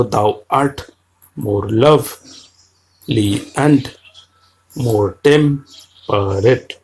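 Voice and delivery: very slow, foot by foot with the stress on the second beat; iambic reading. Shown as a demonstration. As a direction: scan the line slowly. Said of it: "আবার দেখো".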